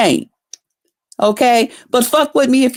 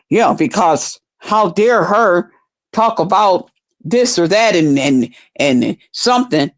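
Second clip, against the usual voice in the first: lower, slow sneering impression of him, a light put-on; mocking him; 4 semitones lower than usual. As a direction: drop lower and drawl his excuse to ridicule it. Mocking what he would say.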